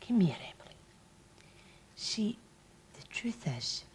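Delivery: whisper. Acted. Luring child in whisper.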